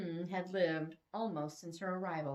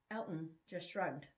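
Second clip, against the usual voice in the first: ordinary delivery, nothing marked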